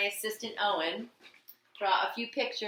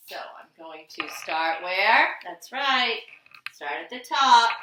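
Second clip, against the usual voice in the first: teacher prompt; 10 dB louder than usual. Shown as a demonstration. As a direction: ask the class and answer brightly. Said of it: "quizzing the class".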